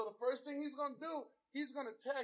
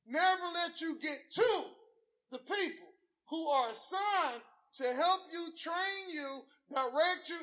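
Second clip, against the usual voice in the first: preaching; 4 semitones higher, 5 dB louder than usual. Acted. Preaching at full force.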